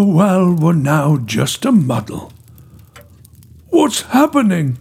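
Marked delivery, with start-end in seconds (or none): 3.7-4.8 high, startled questioning cry; alarmed. A narrator's reading. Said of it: "she cried in alarm".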